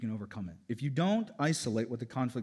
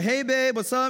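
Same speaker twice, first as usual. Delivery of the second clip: mock flirty husband, higher and louder; husband's come-on; 12 semitones higher, 10 dB louder than usual. Husband approaching wife, hammed-up flirty. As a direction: ham up a guy hitting on his wife. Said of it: "He's like".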